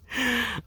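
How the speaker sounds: bright high laugh at himself; short silly high laugh; high giggle. Real, not acted. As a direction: giggle at your own silliness. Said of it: "I'm silly".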